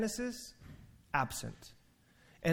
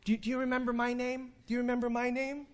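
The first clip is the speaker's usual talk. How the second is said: put-on higher, eager child asking; a kid hoping you remember; 6 semitones higher, 3 dB quieter, faster than usual. Students pestering a teacher, lightly voiced eager kid. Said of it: "if you've ever worked with kids".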